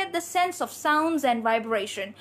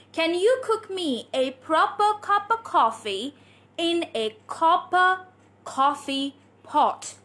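slow, deliberate, word-by-word delivery; tongue-twister demo; slower than usual. Shown as a demonstration. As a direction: teach the whole twister at a slow, measured pace. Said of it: "This is your next tongue twister".

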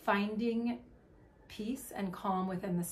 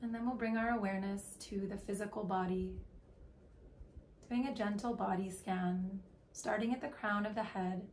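guided meditation; 4 dB quieter than usual. Acted. Leading listeners into breath and body awareness, calm meditation voice. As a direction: guide them gently, letting phrases stretch and settle.

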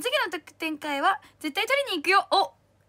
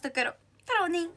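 ordinary delivery

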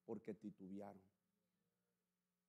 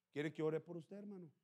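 ordinary delivery

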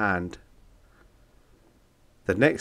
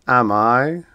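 loud, gruff storybook monster; 4 semitones higher, 7 dB louder than usual. Acted. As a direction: boom it out like a smug monster. Monbeast voiced.

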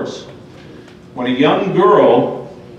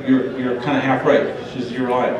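ordinary talk

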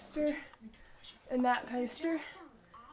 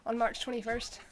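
ordinary delivery

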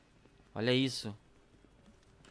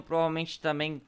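normal talking voice

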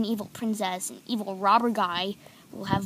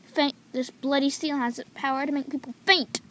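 normal talking voice

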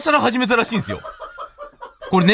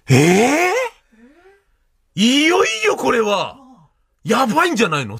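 alarmed shock; 5 semitones higher, much slower than usual. Retold or imitated. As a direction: jolt up in alarm. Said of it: "つって".

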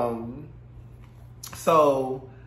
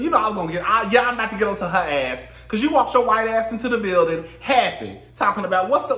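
louder, higher mock outrage; ranting; 7 semitones higher, 4 dB louder than usual. Real, not acted. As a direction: tear into her.

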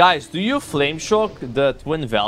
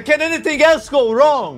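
much higher and louder, fed-up cry; exasperated outburst; 12 semitones higher, 6 dB louder than usual. Real, not acted. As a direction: throw the question up in despair. Dying again in the raid.

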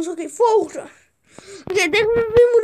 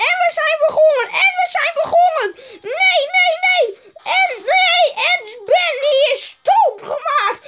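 high, excited play-by-play; mock sports commentator; 5 semitones higher than usual. Gamer commentating as the round begins, high excited play-by-play. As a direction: call the action as an excited commentator. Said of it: "dit is uw commentator".

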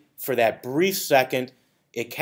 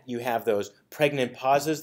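ordinary talk

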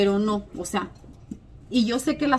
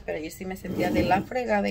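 normal talking voice

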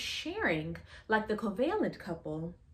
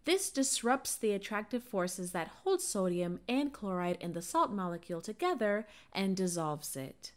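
ordinary delivery

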